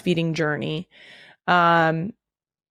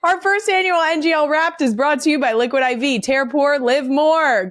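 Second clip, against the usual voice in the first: higher upbeat sponsor read; 7 semitones higher than usual. Acted. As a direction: sell the sponsor brightly.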